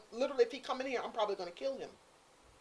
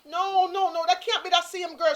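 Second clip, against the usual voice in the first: no no no, louder and higher, can't accept it; loud disbelieving protest; 8 semitones higher, 10 dB louder than usual. Retold or imitated. Refusing to believe the neighbor about the girl, loud disbelief. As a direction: shout your disbelief at the neighbor.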